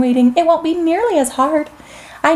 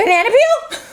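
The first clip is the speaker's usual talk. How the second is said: higher guess melting into a laugh; playful giggling guess; giggly guess; 7 semitones higher than usual. Acted. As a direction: laugh at your own silly guess.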